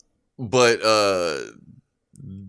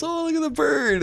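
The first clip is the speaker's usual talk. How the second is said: much higher, sweet cooing at a pet; cooing sister-in-law. Retold or imitated. Sister-in-law crouching to coo at the parrot, high sweet voice. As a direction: coo at the bird like a doting visitor. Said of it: "she gets down and goes".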